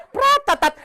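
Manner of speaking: loud mouth-made rifle burst; gunfire sound. Retold or imitated. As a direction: imitate automatic fire loudly. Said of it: "gunfire imitation".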